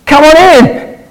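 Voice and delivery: high welcoming call to someone outside. Retold or imitated. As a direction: call them in from the doorway. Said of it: "so to the person outside, I went out and said".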